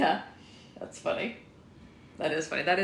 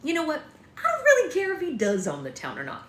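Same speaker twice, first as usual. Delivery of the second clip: louder, higher fed-up sheriff; exasperated outburst; 11 semitones higher, 6 dB louder than usual. Acted. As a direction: burst out in frustration. Sheriff loses patience.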